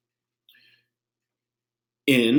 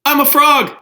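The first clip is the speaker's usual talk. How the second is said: high, loud, excited pig voice; squealing Piggy announcing her news; 9 dB louder than usual. Acted. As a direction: shout it out like a giddy little pig.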